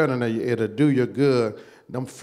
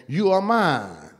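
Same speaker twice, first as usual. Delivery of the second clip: slow, high, excited "You are mine."; 4 semitones higher than usual. Shown as a demonstration. Pastor reveals God's promise to the church, slow and elated. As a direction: let the joy lift and slow the words. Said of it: "you ought to get excited what he says next".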